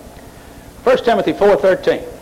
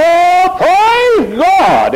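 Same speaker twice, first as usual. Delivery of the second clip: high, loud, gushing cry of praise; mocking a churchgoer's loud, high rapture; mock ecstatic praise; 13 semitones higher, 4 dB louder, much slower than usual. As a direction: ham up a worshipper carried away. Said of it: "And everybody".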